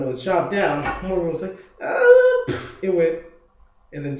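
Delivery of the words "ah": very high, loud effort shout; punching yell. Retold or imitated. Morgan hitting the punching bag, high effort yell. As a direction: let out a sharp yell as you strike.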